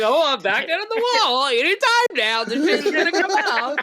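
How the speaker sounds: high, teasing mock voice of the lazy players; high laughing parody voice; mocking quote. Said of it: "They're like".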